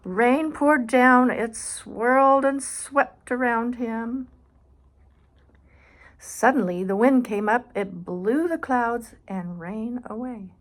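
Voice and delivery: dramatic storybook storm; dramatic slow narration. Acted. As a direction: build the storm slowly. Reads picture book aloud.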